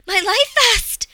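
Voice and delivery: high, very loud panicked shout. A narrator's reading. Passenger shouting as she is held at open exit, shouted. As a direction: shout it out over roaring wind. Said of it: "I shouted".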